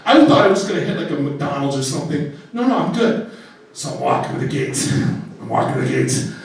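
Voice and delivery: ordinary talk, nothing marked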